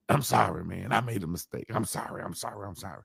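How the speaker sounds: a mechanic's sheepish apology dying away; fading apology. Retold or imitated. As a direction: let each sorry fade to almost nothing.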